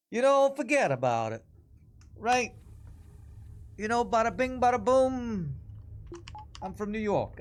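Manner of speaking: high, hammed-up New Yorker patter; New York accent. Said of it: "supposed to be a New York accent".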